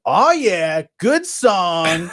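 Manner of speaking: high, pumped-up "oh yeah"; excited exclamation. Retold or imitated. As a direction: cheer the song on like a fan. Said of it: "and I would go".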